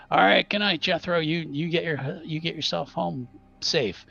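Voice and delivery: high, cheery goodbye called to a friend; friendly man's farewell. Acted. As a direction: call goodnight like a good-natured local. GM voices man leaving Dustin's.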